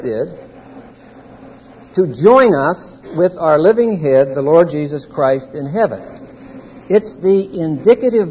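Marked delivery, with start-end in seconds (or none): none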